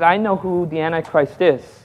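low, hushed solemn announcement; solemn, weighty revelation, kept quiet. Retold or imitated. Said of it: "I said it very solemnly".

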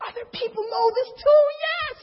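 very high, hammed-up delight; elated squeal. New believers finding other people know this, squealing with joy. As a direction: squeal it as a joyful discovery.

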